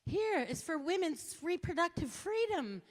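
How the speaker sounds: high, loud canvasser pitch; hammed-up sales pitch; mock petitioner. Speaker mimicking a rest-stop petitioner, high and loud.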